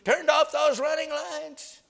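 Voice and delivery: high, slow furious bellow over the PA; captain blasting the crew over the loudspeaker, furious; angry captain yelling. Retold or imitated. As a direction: roar it down the ship's speaker. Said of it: "He came on the P A system".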